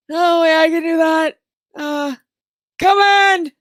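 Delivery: put-on high loud old man voice; old wizard. Wizard letting the party in, high old voice. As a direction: wave them in gruffly.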